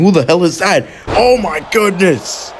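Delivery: high, stunned play-by-play; shocked call. Real, not acted. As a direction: shout out the surprise attacker in disbelief. Commentator shocked by a surprise attack.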